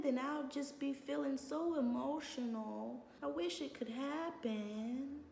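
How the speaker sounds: high, soft put-on pleading; fake emotional. Pretending to be upset to get what she wants, mock-pleading. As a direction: ham up a helpless sigh. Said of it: "pretend that you're emotional".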